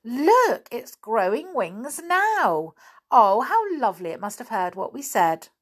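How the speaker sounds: high-pitched, delighted exclamation; delighted cry. A narrator's reading. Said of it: "cried out in delight".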